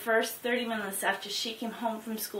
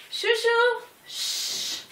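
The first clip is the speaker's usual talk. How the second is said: hushing a pet; 13 semitones higher, much slower than usual. Real, not acted. Dog barking during video.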